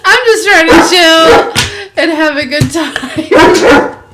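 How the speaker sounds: high and loud, laughing almost to tears; laughing exasperation. Real, not acted. Streamer laughing helplessly at her dog's interruption. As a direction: laugh helplessly while complaining.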